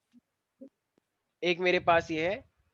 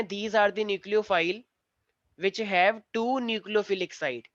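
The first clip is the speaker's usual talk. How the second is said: very slow, measured dictation; much slower than usual. Shown as a demonstration. Teacher asking all the children to write, slow dictation. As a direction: dictate it word by word for note-taking. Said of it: "सारे बच्चे जरा लिखें".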